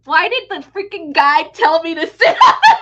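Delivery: loud, high laughing outburst; laughing shout. Real, not acted. Retelling the guide's request, laughing loudly. Retelling being told to sit.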